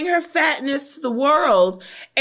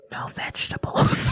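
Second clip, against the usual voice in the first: hushed, high imitation behind a hand; hammed-up secretive whisper; mock whisper; 6 dB quieter than usual. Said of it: "covered her mouth and said".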